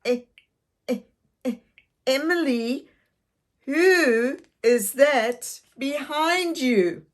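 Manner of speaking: nervous stammer. Acted.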